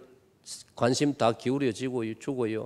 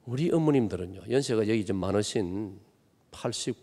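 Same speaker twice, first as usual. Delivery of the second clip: ordinary talk, nothing marked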